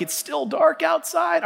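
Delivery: high, drawn-out sleepy grumble; mock groggy complaint. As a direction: moan like you just woke up. Groggy self at dawn.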